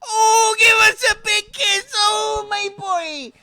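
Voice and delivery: high, loud, crying with joy; overjoyed mum. Acted. GM as mum greeting her son Arthur, high tearful cry. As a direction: wail it like a mum reunited with her boy.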